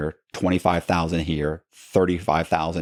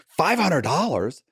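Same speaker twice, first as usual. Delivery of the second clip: higher, slow incredulous echo, hand over mouth; stunned disbelief; 10 semitones higher, slower than usual. Retold or imitated. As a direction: hold back a laugh as you repeat it. Stunned at tiny fee.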